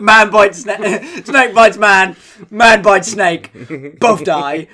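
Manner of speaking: slow, loud newsreader headlines; mock-grave bulletin read, edged with laughter; Trevor McDonald. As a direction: punch out each headline like a news anchor. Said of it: "imagine Trevor McDonald reading it out like that".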